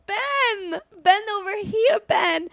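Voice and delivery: put-on high, drawn-out Italian-accented call; mock Italian accent. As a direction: imitate an Italian woman calling a man over. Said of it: "it was a good accent you did".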